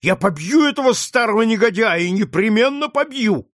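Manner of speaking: put-on a boy swearing revenge on the old villain; young George vows to beat the trader, high and angry; furious boy's threat. A narrator's reading.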